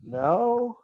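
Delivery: high, rising, questioning denial; brazen denial. Acted. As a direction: play dumb, voice sliding way up. Denying what was just pulled out.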